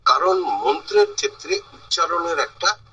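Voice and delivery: normal talking voice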